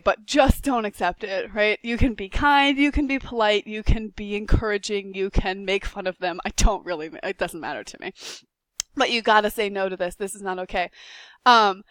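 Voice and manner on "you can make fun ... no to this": ordinary talk, nothing marked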